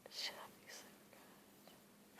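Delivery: soft bedtime whisper. Real, not acted. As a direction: whisper so nobody wakes. Whispering late at night.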